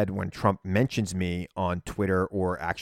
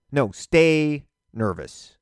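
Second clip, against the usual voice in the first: drawn out and louder, every word weighted; slow emphatic urging; 5 semitones higher, 5 dB louder, much slower than usual. Real, not acted. Host urging his listeners to stay nervous, slow and emphatic. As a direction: press it home slowly.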